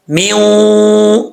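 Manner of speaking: "میوں" with a long hummed oo; rounded nasal waw. Shown as a demonstration. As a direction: round the lips and hold "میوں". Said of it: "میوں پڑھیں گے". ("میوں" غنہ کے ساتھ لمبا)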